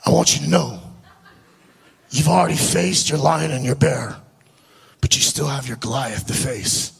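low imitated accent of an eccentric prophet; Billy's accent. Crazy Billy prophesying over the storyteller, low imitated accent. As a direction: put on his accent, pitched well down. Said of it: "I gotta do his accent".

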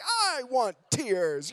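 slow, drawn-out plea for tears; slow mock whine; mock pleading. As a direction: stretch it out like a whiny wish. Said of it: "I'm like".

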